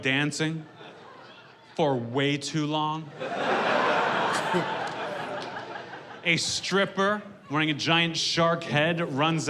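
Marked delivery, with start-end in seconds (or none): 3.1-6.2 breathy laugh with no voice; real laugh. Real, not acted. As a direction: laugh at his own story. Laughs at his own joke.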